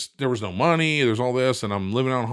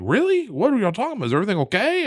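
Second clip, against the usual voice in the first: high, louder anxious questions, imitating the wife; worried wife; 11 semitones higher, 4 dB louder, faster than usual. Wife worrying the call means trouble, high anxious questions. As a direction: fret about what's wrong, voice way up. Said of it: "she switches to".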